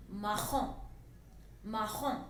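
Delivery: marron with a throaty r and a nasal "on" ending. Shown as a demonstration. Teacher drilling marron with its nasal ending. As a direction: let the on of marron ring through the nose. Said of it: "Again nasal sound".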